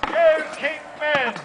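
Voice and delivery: stretched-out crowd cheer. Shown as a demonstration. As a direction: lead the crowd's cheer, slowly.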